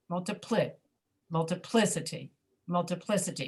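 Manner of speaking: "multiplicity" with the accent on PLI; third syllable accented. Shown as a demonstration. Teacher confirming the answer, "multiplicity" stressed on PLI. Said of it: "Which one is accented?".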